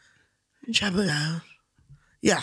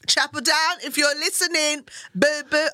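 higher and louder, hailing the sponsor cheekily; calling out; 8 semitones higher, 3 dB louder than usual. Pretend. Guest hails Chapel Down on air, playful call-out. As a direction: shout out to the listening brand.

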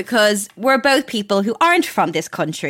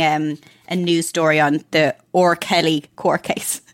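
ordinary talk, nothing marked

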